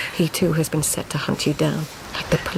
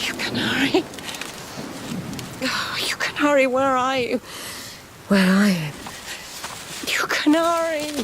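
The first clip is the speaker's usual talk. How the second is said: eerie calling voice; 7 semitones higher than usual. Acted. Dream voice calling a canary.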